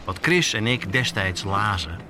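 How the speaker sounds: usual speaking voice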